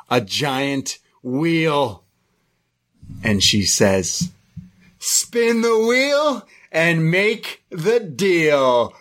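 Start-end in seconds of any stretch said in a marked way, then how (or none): 5.0-8.9 over-the-top spooky host; very slow, high, drawn-out dramatic proclamation; Shotzi Blackheart. Retold or imitated. Halloween host announcing the wheel, hammed-up dramatic mode. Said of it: "she is way over the top in dramatic mode".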